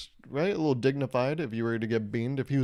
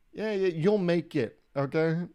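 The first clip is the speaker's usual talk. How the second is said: mock reassurance; 6 semitones higher, slower than usual. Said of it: "And I was like".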